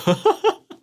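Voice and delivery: squeaky laugh breaking out; high burst of laughter. Real, not acted. Streamer laughing at own sad anime arc, high giggle.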